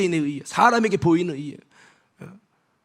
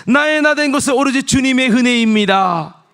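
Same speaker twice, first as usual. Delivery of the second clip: proclaiming shout; 6 semitones higher, 7 dB louder than usual. Real, not acted. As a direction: ring it out high and loud. Preacher proclaims grace. (높고 크게 선포하듯)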